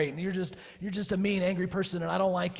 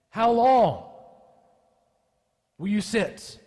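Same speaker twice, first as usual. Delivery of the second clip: much higher and louder impassioned preaching; preaching at full force; 7 semitones higher, 8 dB louder than usual. Acted. Pastor voicing the psalmist's plea to God, full force.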